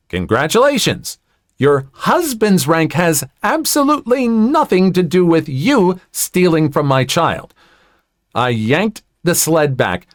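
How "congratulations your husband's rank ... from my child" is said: higher, emphatic indignation; indignant retort. A narrator's reading. Mother to entitled woman pulling rank, indignant retort. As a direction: put her in her place, pitched up.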